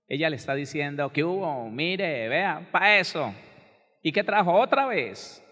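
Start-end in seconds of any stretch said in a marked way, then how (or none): 1.1-5.4 drawn-out, scolding nag, rising louder at the end; imitated nagging. Wife pestering her husband over what he brought, mock nagging. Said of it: "ella le cantaletea".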